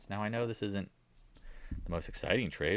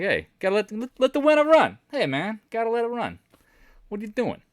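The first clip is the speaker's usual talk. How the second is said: much higher, loud mob-guy voice, hammed up; Italian mobster; 8 dB louder than usual. Mafia relatives scolding traders to let the winner run, hammed-up mobster yell. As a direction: bark it like a Sopranos wiseguy scolding you. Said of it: "they'd be like".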